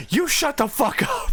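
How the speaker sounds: a sudden angry shout at a husband, played as a joke; mock angry snap. Wife shuts down her husband in a mock angry shout.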